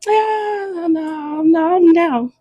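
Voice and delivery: put-on high, whining kid refusing; whiny kid voice. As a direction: play the daughter whining no. Child voiced answering dad.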